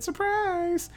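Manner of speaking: very high, sing-out SURPRISE; mock eerie surprise. Invisible man taunting her by text, high mock surprise. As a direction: imitate a creepy text in a high pitch. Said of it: "Why would he say it like that".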